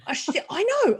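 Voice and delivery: tripping over words in mock fluster. Flustered after bold question.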